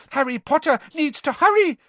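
high, loud elf squeak; squeaky house elf. Acted. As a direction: squeak it out urgently. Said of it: "squeaked Dobby".

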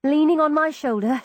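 plain reading voice

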